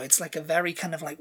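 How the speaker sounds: usual speaking voice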